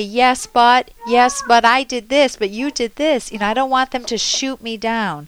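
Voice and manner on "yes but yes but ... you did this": louder, higher mock defensive rebuttal; defensive yes-but retort. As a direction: mimic a partner shooting you down. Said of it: "I want my partner not to say".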